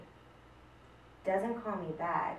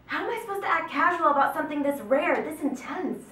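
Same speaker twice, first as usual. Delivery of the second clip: higher, urgent appeal; pleading that this is rare; rising passion; 6 semitones higher, 3 dB louder than usual. Acted. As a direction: push it up with feeling. Passionate plea in the monologue.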